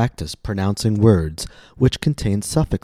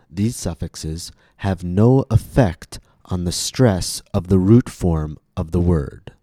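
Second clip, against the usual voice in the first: normal talking voice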